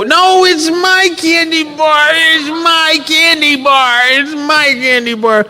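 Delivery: put-on high, slow little girl clinging to her candy; a small child refusing, whiny and high; stubborn little girl.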